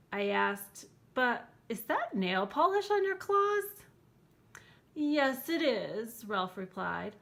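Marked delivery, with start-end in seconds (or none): 1.2-3.8 high, loud disbelieving question; astonished child. Acted. Child stares at Ralph's painted claws, astonished. 4.9-6.2 proud silky monster. Acted. Ralph replies.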